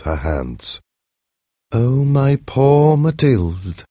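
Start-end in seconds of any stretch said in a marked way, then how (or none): 1.7-3.8 slow, louder mournful pity; aching for her friend, drawn out; sad voice. Acted. Holding her friend's hands, slow sad pity. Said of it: "she said in a sad voice".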